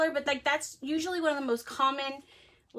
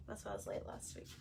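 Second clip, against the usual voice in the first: whispered aside; 3 semitones lower, 16 dB quieter, faster than usual. Real, not acted. Vlogger to viewers, a quick whispered aside. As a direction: whisper it fast under the breath.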